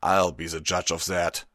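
firm, gruff retort with some force; muttered. A narrator's reading. Guard captain answering the intruder, forceful mutter. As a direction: mutter it firmly, still audible. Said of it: "Brightshield muttered".

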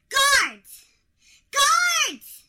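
high, loud call for help; shouting for guards. Acted. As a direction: yell for the guards. Calling for her guards.

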